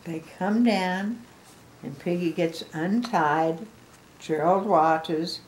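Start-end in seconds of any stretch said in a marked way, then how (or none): none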